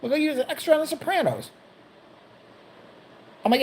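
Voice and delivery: usual speaking voice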